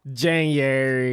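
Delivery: slow, stretched Chicago vowels; Chicago accent. Retold or imitated. Friend echoing the Bears fan bit, slow mock Chicago accent. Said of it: "Super Chicago divorce".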